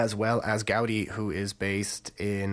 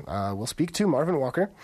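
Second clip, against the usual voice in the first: ordinary talk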